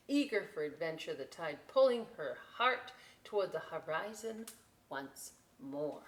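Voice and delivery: ordinary delivery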